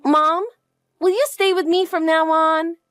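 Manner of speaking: put-on high, childlike and hopeful; little girl asking Mom to stay; hopeful young girl. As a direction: ask like a kid longing to be held. Child voiced by the adult.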